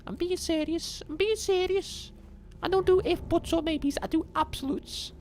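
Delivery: very high, squeaky mock Scouse accent; high Scouse impression. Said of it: "search up Scouse".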